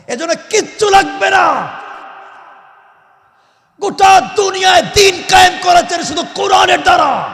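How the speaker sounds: high, loud shouted declaration; impassioned, sustained pulpit shout; full-force preaching. Real, not acted. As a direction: thunder the point to the crowd.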